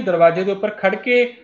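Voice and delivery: normal talking voice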